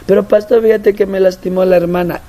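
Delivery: high mock whine; mock the complainer; whiny complaint. Member complains of sister. (queja quejumbrosa, aguda)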